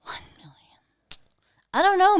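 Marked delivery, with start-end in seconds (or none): 0.0-0.5 whisper. Real, not acted. Whispered to herself.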